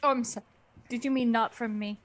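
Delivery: usual speaking voice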